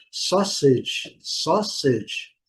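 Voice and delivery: sausage with an open O, SAW-sidge; English sausage. Shown as a demonstration. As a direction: read sausage with an open aw vowel. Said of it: "um som de O".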